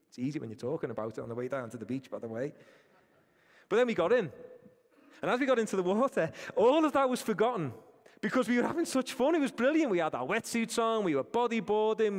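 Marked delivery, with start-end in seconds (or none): 3.7-12.2 excited. Real, not acted. Recalling fun in the waves.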